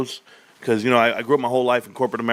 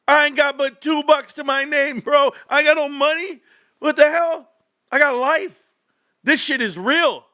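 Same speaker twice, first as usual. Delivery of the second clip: high, incredulous venting; exasperated rant. Real, not acted. Streamer answering money accusations, high and exasperated. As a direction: vent that you are broke, pitched way up.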